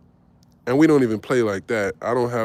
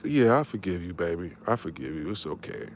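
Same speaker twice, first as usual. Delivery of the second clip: fake soothing; 8 semitones lower, 8 dB quieter than usual. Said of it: "I acted like I didn't care".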